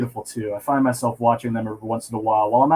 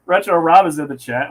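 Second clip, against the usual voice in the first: laughing, higher and louder; 4 semitones higher, 7 dB louder than usual. Real, not acted. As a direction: say it through a laugh. Repeated while laughing.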